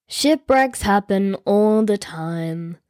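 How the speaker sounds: low, slow, dismissive king; king retorting. A narrator's reading. King dismissing his noble, low and slow. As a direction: brush it off like an impatient ruler. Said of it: "the king retorted".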